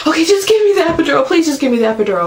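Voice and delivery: high, fast, pleading; frantic retold begging; desperate plea. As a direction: beg for the epidural, rushed and high. Begging for epidural in labor.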